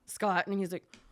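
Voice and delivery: ordinary delivery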